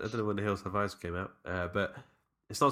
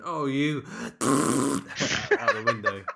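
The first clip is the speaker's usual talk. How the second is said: mock angry yell at the driver; hammed-up furious shout out the window; imitating mum's road rage; 5 semitones higher, 6 dB louder, slower than usual. Mum yelling at the driver who stole her spot, mock angry shout. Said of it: "the guy went in there first, went".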